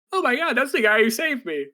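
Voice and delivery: high, starry-eyed recognition; gushing princess. Retold or imitated. Princess waking to see her rescuer, high gushing. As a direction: gush like a smitten princess.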